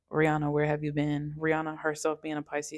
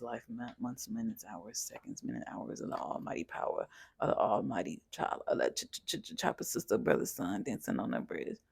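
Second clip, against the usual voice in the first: mumbling; 7 semitones lower, 5 dB quieter than usual. Pretend. Mumbled nonsense lyric riff.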